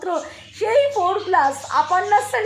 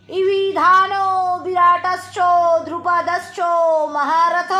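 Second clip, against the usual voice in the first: slow, steady Sanskrit chanting; Gita recitation; slower than usual. Acted. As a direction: intone each verse like a temple reading.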